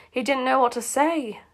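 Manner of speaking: ordinary delivery, nothing marked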